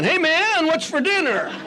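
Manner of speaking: high-pitched mock stoner teenager; hammed-up high call; spaced-out hippie son. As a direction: imitate a hippie kid hollering to his mother. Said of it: "Called his mother, "Man".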